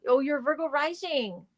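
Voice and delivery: usual speaking voice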